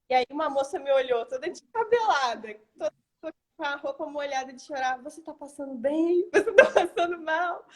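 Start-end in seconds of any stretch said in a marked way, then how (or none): 5.0-7.6 retold worried questions, pitched up; alarmed concern. A stranger asks the crying mother if she's okay, alarmed. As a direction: imitate a concerned stranger, voice rising.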